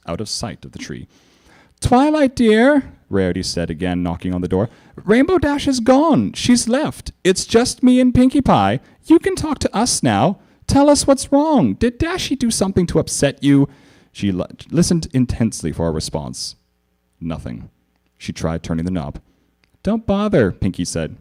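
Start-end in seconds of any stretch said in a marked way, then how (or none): 1.8-2.9 high, genteel lady voice; Rarity voice. A narrator's reading. Rarity voiced calling Twilight. 5.0-13.7 high, coaxing lady voice; Rarity pleading. A narrator's reading. 19.8-20.5 high bubbly pony; Pinkie voice. A narrator's reading.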